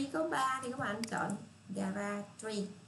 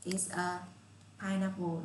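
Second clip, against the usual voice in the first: slow model answer; much slower than usual. Shown as a demonstration. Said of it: "các bạn phải trả lời".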